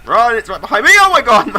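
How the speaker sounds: panicked high shouting; scared horror game streamer. Real, not acted. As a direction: yell in panic while fleeing. Fleeing dog in game.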